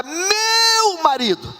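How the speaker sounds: high, long-drawn "meu"; adoring cry. Acted. Preacher showing how the wife says it, adoring cry. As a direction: savour the possessive, held high. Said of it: "da maneira como ela coloca".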